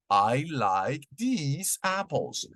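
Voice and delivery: "I like these apples" spoken very slowly; very slow model sentence. Shown as a demonstration. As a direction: linger on "these" to teach it. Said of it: "Un ejemplo sería".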